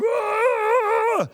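put-on sudden high yelp of the old temper; a high mock outburst held in check; inner urge to yell. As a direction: let the old chef burst out high. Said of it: "everything in me is going".

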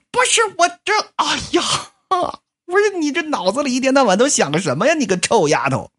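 husband exasperated by his scatterbrained wife; exasperated outburst. Acted. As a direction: throw up your hands at her. Joseph exasperated at Susie.

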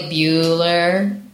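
low, droning roll-call; deadpan, drawn-out monotone; monotone drone. Acted. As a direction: hold it on one dull note. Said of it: "talk real monotone".

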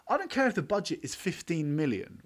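ordinary delivery

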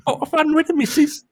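high, hammed-up Cockney boy voice; Bart doing Cockney urchin. Teasing about the friend's son, mock Cockney child voice. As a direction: squeak it out like a cheeky Cockney kid. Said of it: "your son being like, like Bart".

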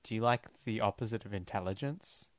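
hushed, secretive recruiter voice; stage whisper. Acted. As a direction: lean in and whisper the offer. Recruiting Anthony into Spectre.

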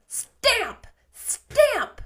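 high, loud stamped-out word; angry exclamation. A narrator's reading. Anne stamps at Missus Rachel, furious. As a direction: punch each word like a furious foot.

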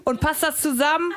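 interrogating shout; chef yelling. A narrator's reading. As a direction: snap the question.

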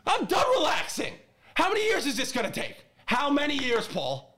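high-pitched fed-up outburst; an exasperated fan boiling over; done and fuming. Real, not acted. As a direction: pitch it up like you've snapped. Fan ranting about the team.

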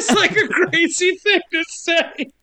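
high-pitched talking through laughter; laughing. Real, not acted. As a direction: comment while breaking up laughing. Laughing at the retold line.

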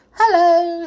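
high, loud storybook hello; hearty greeting. A narrator's reading. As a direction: call a big proud hello to the crowd. Bear greets the crowding toys.

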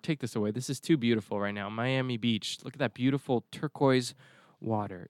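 ordinary talk, nothing marked

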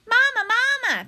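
put-on high, loud baby-bird cry; high-pitched little gosling voice; peeping gosling. A narrator's reading. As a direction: call out like a tiny, insistent hatchling. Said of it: "peeped the gosling".

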